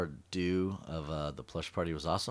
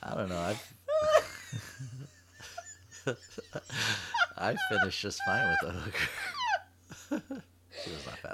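laughing; 8 semitones higher than usual. Real, not acted. Joke through laughter.

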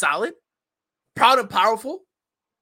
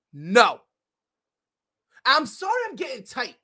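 ordinary talk

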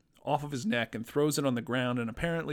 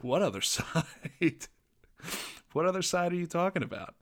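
laughing, baffled reaction, pitched up; incredulous; 6 semitones higher than usual. Retold or imitated. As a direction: question it in amused disbelief. Retells own baffled reaction, laughing.

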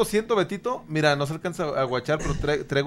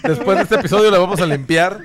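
laughing; 4 semitones higher, 10 dB louder than usual. Real, not acted. Laughing about dirty harmonica.

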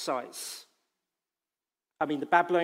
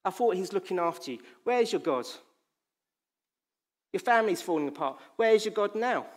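higher, louder taunts; mocking enemy; 4 semitones higher, 3 dB louder than usual. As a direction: hammer him with scorn. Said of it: "starts mocking".

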